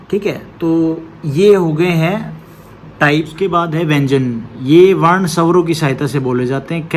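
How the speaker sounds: ordinary talk, nothing marked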